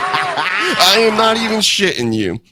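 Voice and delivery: high, slow words through laughter; laughing. Real, not acted. Admitting he thought Ospreay was Australian, laughing.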